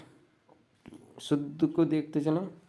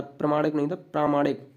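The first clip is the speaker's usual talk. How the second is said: normal talking voice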